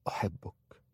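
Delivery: soft, whispered declaration of love; hushed, tender whisper; whispered confession. A narrator's reading. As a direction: whisper it intimately to a lover. Tender confession of love, face held.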